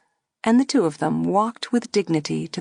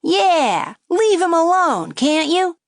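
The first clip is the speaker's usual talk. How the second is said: high, loud wisecracking puppet; a brash ventriloquist dummy needling; cheeky dummy voice; 11 semitones higher, 5 dB louder, slower than usual. A narrator's reading. Dummy mocking the girl at the table, high and brash. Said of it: "the dummy said".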